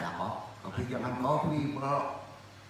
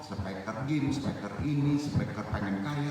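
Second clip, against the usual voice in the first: ordinary talk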